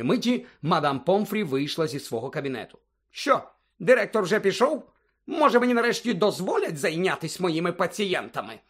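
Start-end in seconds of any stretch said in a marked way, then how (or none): 3.1-8.6 bad-tempered matron. A narrator's reading. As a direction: complain crossly at being kept waiting. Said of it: "була явно в кепському гуморі". (роздратована медсестра, високим голосом)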